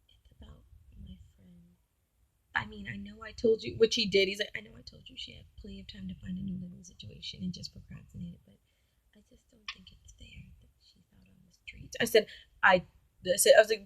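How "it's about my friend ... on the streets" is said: whispered plaintive excuse; whispered self-pitying excuse; hushed whine. Retold or imitated. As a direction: murmur it like a guilty boyfriend. Boyfriend quoted whining.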